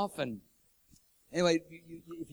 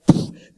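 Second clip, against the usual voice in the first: explosive puff of air; sudden loud whoosh from the lips; imitated burst; 18 dB louder than usual. Imitating burst of light at creation.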